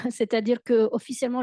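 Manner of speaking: ordinary delivery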